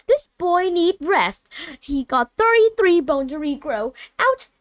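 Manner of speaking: loud, slow, high shout to clear the room. A narrator's reading. Matron shouting visitors out of the hospital wing, loud and high. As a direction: bellow them out of the ward, rising to a shriek.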